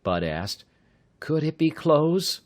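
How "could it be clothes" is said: put-on slower, higher young voice; curious young man. A narrator's reading. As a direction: wonder aloud like an eager youth. Bud wonders.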